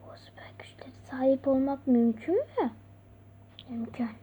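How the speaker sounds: unvoiced sinister whisper; villain whisper. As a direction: whisper it like a schemer.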